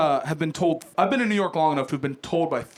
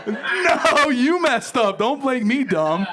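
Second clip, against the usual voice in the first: high, protesting comeback; indignant retort; 6 semitones higher, 3 dB louder, much slower than usual. Retold or imitated. Comedian retells snapping back at his friend, indignant. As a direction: protest in a rising huff, higher-pitched. Comic retold quarrel with friend.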